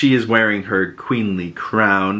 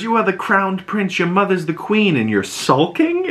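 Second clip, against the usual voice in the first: higher-pitched, retold disbelief; incredulous scolding; 10 semitones higher than usual. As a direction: chide a sulking prince in mock disbelief. Baela chides pouting prince.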